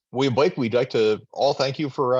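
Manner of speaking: normal talking voice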